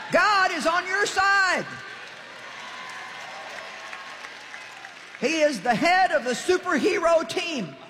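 rally the congregation, pitch climbing high; rousing preaching. Real, not acted. Preacher telling people God is on their side, rousing preaching.

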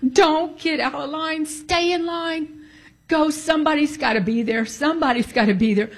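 high, pleading through tears; tearful plea. Retold or imitated. Telling her friend to stay in line, tearfully pleading. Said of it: "I started crying".

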